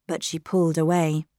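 plain reading voice

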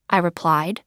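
ordinary delivery

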